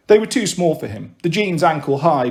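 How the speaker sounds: plain reading voice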